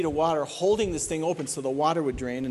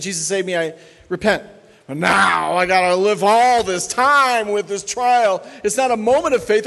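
high, loud, driving sermon voice; preacher rising to a high, loud climax; full-force preaching; 9 semitones higher, 8 dB louder than usual. Acted. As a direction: thunder it from the pulpit.

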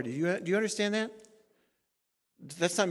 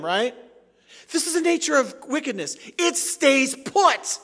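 preaching at full force; 2 semitones higher, 11 dB louder than usual. Acted. Pastor driving home his point to the congregation, fiery preaching. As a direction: thunder it from the pulpit.

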